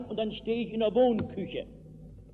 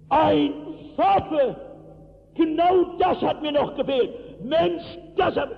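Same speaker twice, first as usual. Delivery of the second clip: yelled high and loud; a young miner roaring at the new pastor, loud shout; 6 semitones higher, 3 dB louder than usual. Retold or imitated. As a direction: throw your hands up and bellow it. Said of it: "also ich kann nicht so schreien".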